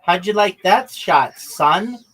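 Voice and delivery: gloating shout. Real, not acted. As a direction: crow it over him after nailing the shot. Taunting friend after a good shot.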